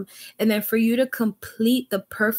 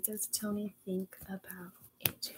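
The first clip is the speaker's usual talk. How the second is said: whispering; 17 dB quieter than usual. Real, not acted. Whispered question to the cards.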